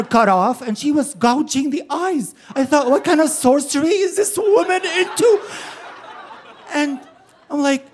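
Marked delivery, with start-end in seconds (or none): 2.9-5.4 put-on high, laughing kid's voice; scared child. Boy suspecting mother of sorcery, high and laughing. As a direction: play the spooked eight-year-old.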